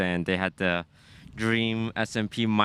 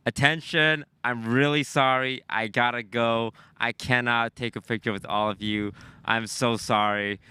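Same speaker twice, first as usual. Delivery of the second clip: usual speaking voice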